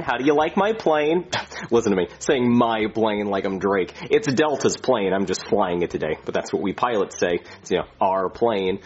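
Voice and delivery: ordinary delivery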